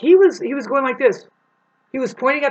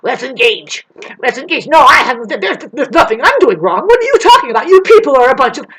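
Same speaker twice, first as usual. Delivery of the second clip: imitating adamant man; 3 semitones higher, 9 dB louder than usual. The old man picking a fight, loud mock rant. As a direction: push into a confrontation, hammed up. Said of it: "He was adamant about".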